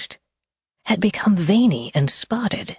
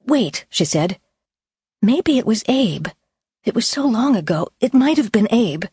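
anxious woman voice; 6 semitones higher than usual. Acted. Claire, hurried and anxious.

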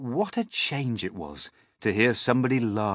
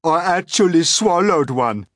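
high, loud, proud Earthworm; proud declaration; 11 semitones higher, 12 dB louder than usual. A narrator's reading. Earthworm declares its feat to the others, proudly. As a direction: declare it as your great feat. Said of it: "the Earthworm declared proudly".

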